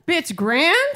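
high, sharply rising mock disbelief; incredulous friend. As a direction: imitate a friend balking at a word. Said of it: "So she says".

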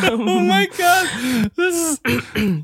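high squeal of laughter; laughing. Real, not acted. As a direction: crack up mid-sentence. Laughing at partner's joke.

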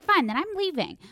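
quoting mom's huffy exit line, pitched high; mock angry snap. Retelling mom storming out after the fight, a high huffy snap. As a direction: snap it like storming out, half-mocking. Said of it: "she literally got so mad at me".

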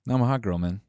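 usual speaking voice

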